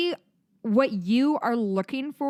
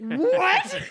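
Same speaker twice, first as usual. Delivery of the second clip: incredulous laughing what; 11 semitones higher, 5 dB louder than usual. Retold or imitated. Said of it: "I'm like".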